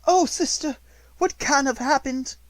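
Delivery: a flustered spinster close to tears; fretful sister. A narrator's reading.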